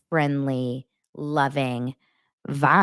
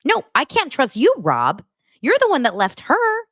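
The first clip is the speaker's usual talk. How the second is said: louder, higher outrage; talking back at Rob on the screen, outraged; indignant retort; 8 semitones higher, 5 dB louder than usual. Real, not acted. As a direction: call him out.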